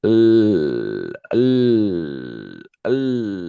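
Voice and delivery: "L" as a sustained consonant; sound of L. Shown as a demonstration. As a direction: hold "L" as a long humming lll. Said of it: "el sonido de la".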